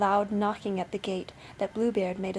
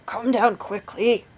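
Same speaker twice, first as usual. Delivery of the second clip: loud, slow order from a husband; angry shouted command; 7 dB louder, slower than usual. A narrator's reading. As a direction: shout up the stairs. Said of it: "cried Bluebeard".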